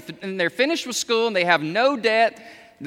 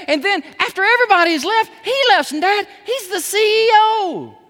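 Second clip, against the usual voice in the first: put-on much higher and louder, amazed young son; son's excited report; 10 semitones higher, 6 dB louder than usual. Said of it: "He came home the other day and he said".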